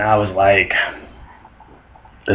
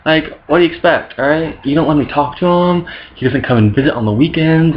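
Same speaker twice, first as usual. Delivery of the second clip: put-on teen Jesus whine; 6 semitones higher than usual. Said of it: "Jesus is all like".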